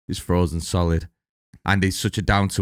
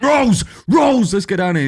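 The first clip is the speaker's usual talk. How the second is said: high, loud imitation of yelling for someone; mock calling out; 7 dB louder than usual. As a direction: call out high and loud, hammed-up. Acts out film character calling Rose.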